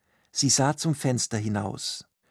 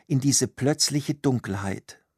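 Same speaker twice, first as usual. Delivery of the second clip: ordinary delivery, nothing marked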